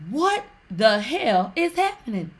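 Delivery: imitating gasping shock, stretched out; acted-out shock. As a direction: act out a stunned onlooker. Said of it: "They gasped".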